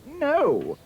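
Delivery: high, loud horrified scream. A narrator's reading. Prince sees the ugly sister's foot fit, high scream. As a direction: scream it in horror. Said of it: "The prince screamed".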